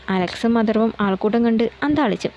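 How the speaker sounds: usual speaking voice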